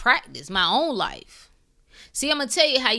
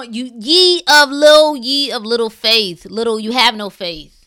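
ordinary talk

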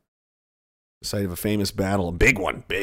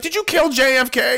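mock accusation; 13 semitones higher, 4 dB louder than usual. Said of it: "it's like".

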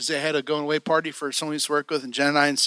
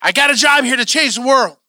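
loud, high indignant insistence; retold heated outburst; frustrated; 8 semitones higher, 5 dB louder than usual. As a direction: fire it off in exasperation. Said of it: "I would get so frustrated".

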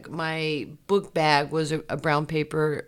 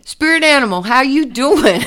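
much higher, much louder cheery hello; excited greeting; 9 semitones higher, 12 dB louder than usual. Retold or imitated. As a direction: shout a delighted hello to a friend. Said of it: "I'm like".

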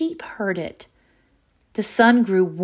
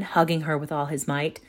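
plain reading voice

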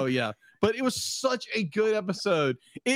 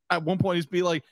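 normal talking voice

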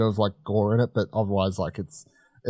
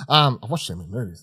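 ordinary delivery

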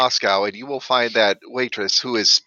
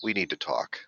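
low, soft and ominous; a much lower, quieter voice of doom from head office; ominous boss voice; 9 semitones lower, 11 dB quieter than usual. Retold or imitated. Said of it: "somebody from head office going".

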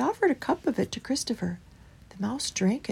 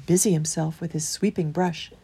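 usual speaking voice

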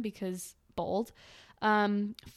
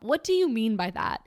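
ordinary talk, nothing marked

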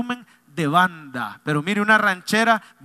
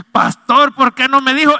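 higher and louder, caught off guard; surprised outcry; 7 semitones higher, 10 dB louder than usual. Retold or imitated. Driver startled to learn his passenger is a pastor, loud surprised outcry. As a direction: blurt it out in shock.

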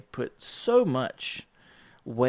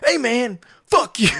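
higher, louder mock comeback; retold angry retort; 11 semitones higher, 5 dB louder than usual. Dishwasher telling the narrator off, mock angry. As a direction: snap it back at him, half joking. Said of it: "He was like".

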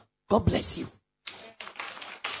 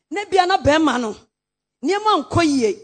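usual speaking voice

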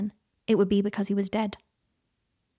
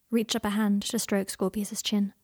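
plain reading voice

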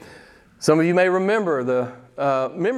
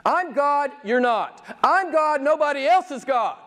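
high-pitched, emphatic divine declaration; God; 8 semitones higher than usual. Acted. Preacher voicing God's words from Isaiah, high and emphatic as God. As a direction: declare it high, like God asserting sole rule. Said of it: "I love it when he talks like this".

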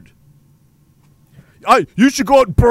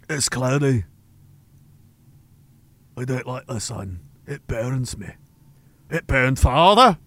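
put-on low, much softer old highlander voice; old Scots highlander; 8 semitones lower, 4 dB quieter than usual. Old Scottish villager grumbling about the sun, low and soft. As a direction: mutter like a wary old Scots crofter.

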